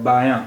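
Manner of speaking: बायां ending in a nasal aa, n not sounded; nasalized vowel ending. Shown as a demonstration. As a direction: leave the end of बायां open and nasalized. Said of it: "यहाँ नून गुन्ना इस्तेमाल हुआ".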